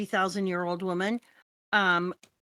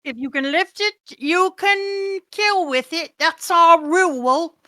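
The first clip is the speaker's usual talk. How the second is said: much higher and louder, slow and proud; booming declaration; 8 semitones higher, 8 dB louder, slower than usual. Acted. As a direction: proclaim it like a barbarian warrior. Voicing Guts' booming rule.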